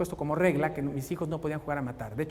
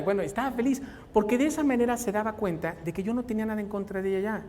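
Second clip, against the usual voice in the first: usual speaking voice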